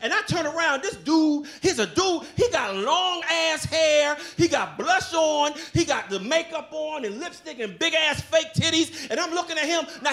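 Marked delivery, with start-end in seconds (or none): none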